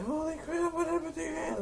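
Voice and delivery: squeaky high chief voice; high alarmed chief. Retold or imitated. The chief squeals at the stoner, very high.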